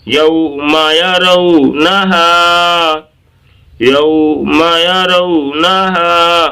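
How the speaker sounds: measured, stretched teaching chant of یوم یرونہا; drawn-out recitation. Shown as a demonstration. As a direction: slowly chant یوم یرونہا with sustained vowels. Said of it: "آپ یہاں کو لے آئیں".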